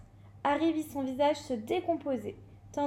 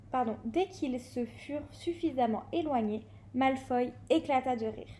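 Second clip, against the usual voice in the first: ordinary delivery, nothing marked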